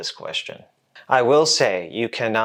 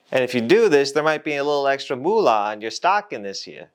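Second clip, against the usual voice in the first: much higher, fast wheeler-dealer boss; greedy old-time boss pitching a deal; 1950s boss talk; 5 semitones higher, faster than usual. Acted. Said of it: "Stop talking like you're from the fifties".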